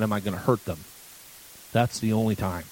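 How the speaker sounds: ordinary delivery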